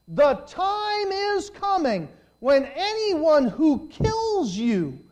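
high, loud proclamation, slowing for weight; full-force preaching. Real, not acted. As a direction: thunder the prophecy out, stretching key words.

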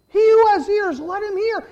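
high, forceful preacher's shout; loud, impassioned call to listen; shouted scripture. Acted. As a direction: cry the verse out to the whole congregation. Preacher repeats verse at full force.

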